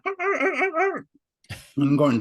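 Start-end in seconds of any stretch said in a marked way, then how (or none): 0.1-1.1 very high-pitched dog barks; imitated dog yelping. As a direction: yelp like an agitated dog. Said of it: "the dog's going".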